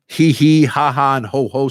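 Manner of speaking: laughter-exercise sounds, starting strong and easing off; spoken mock laugh; simulated laughter. Shown as a demonstration. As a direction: demonstrate the fake laugh syllables in quick pairs. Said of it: "three basic sounds".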